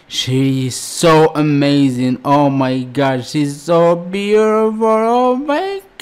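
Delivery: loud, rising, overwhelmed praise; can't contain the excitement; loud excited gushing. Real, not acted. Watching her sing, gushing loudly with excitement.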